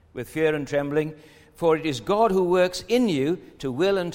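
a preacher reading Paul's letter aloud; scripture reading. Acted. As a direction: proclaim the verses to the congregation. Reads scripture passage aloud.

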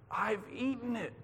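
high, hushed, breathed words; breathed in awe. A narrator's reading. Boy who has just swallowed the page, breathed in awe. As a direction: breathe it out softly, high and full of wonder. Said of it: "he breathed".

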